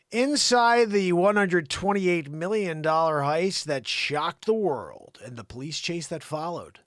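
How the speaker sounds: loud dramatic headline read; news read. Acted. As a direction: announce the heist like a big exclusive. Reads a news story aloud.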